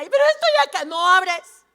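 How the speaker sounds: súplica aguda y fuerte; llanto imitado. Retold or imitated. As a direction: imita a una hermana encerrada, desesperada. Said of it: "Lloraba, yo vi". (ruego gritado, fingiendo desesperación)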